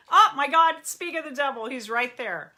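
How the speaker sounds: suddenly spotting someone you just mentioned; surprised. Real, not acted. Therapist on her live stream spotting her husband join: loud delighted surprise.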